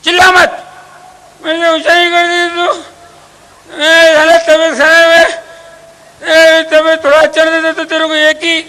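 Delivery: high, loud irritable yell; sick husband yelling at his wife to come; angry sick husband. Acted. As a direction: bark at her though he is ill.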